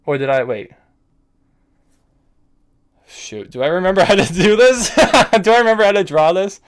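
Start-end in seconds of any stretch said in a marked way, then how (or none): 3.1-6.6 loud, high, half-laughing alarm; excited panic. Real, not acted. Chess player unsure how to draw the endgame, excited panic. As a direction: blurt it with a nervous laugh. Nervous over drawing the endgame.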